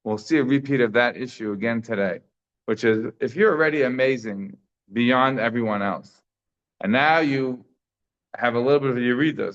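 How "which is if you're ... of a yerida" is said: ordinary talk, nothing marked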